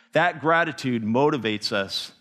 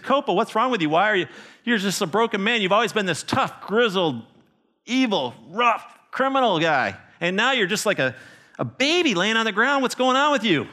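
voiced villagers; 8 semitones higher than usual. Villagers ask weeping Copa.

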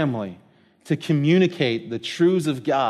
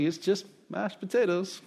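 laughing; 4 semitones higher, 10 dB quieter than usual. Retold or imitated. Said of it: "I was like".

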